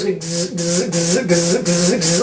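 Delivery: mock buzzing of a jammed brain; brain buzzing zzz. His brain fails to make sense of Nintendo, mock buzzing. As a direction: buzz like a brain that can't compute. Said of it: "my brain just fucking just goes".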